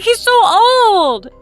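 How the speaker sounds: high, slow, stretched-out shock; dismayed disbelief. Retold or imitated. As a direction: drag it out in horrified surprise. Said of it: "I was like".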